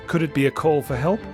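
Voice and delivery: ordinary delivery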